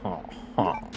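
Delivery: lightly voiced mock villain laugh; Wesker's cartoon villain laugh. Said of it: "like very Saturday morning cartoon villain".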